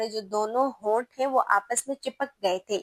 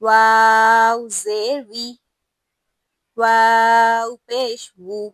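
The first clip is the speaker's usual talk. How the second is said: each harakat chanted slowly and loudly, with pauses between; slow, loud Qur'anic spelling drill; slow recitation drill; 7 dB louder, much slower than usual. Shown as a demonstration. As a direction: spell out each vowel mark like a teacher drilling a class. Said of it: "ایک بار پھر سے".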